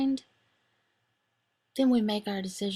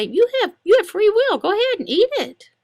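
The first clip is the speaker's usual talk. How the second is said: much higher and louder, pushing the listener; loud challenge; 11 semitones higher, 8 dB louder than usual. Acted. Imagined objector daring him about free will, loud and high. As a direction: goad them to eat it. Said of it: "Suppose you say".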